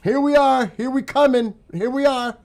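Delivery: high, loud mock call-out; mock announcing. Streamer mimicking forces giving themselves away, mock announcing.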